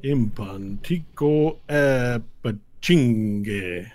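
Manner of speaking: very slow, stretched-out alien syllables; Tabacho speaking Draconic. Acted. Tabacho responding to the gift, slow Draconic pronouncement. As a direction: intone each foreign word with weight.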